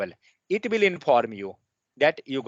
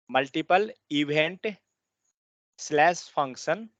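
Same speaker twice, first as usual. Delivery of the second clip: dictating. Shown as a demonstration. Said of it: "So now write down".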